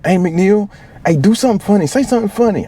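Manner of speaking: a squeaky buddy begging for a joke; high mock platoon mate. Platoon mate urging McNeil to do something funny, high squeaky voice.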